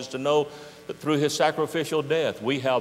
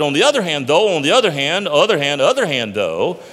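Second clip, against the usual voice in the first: dithering Zode; 2 semitones higher, 8 dB louder than usual. Acted. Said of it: "stopped and said".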